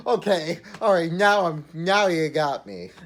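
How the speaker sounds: talking through real laughter; cracking up while talking; laughing. Real, not acted. Cracks up at the joke.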